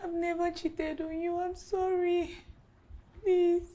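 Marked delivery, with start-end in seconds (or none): none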